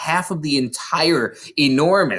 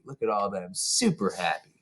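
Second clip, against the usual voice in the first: quiet aside; 3 semitones lower, 8 dB quieter than usual. Real, not acted. Aside to listener.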